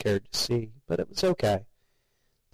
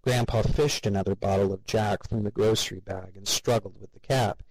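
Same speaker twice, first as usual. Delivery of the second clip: plain reading voice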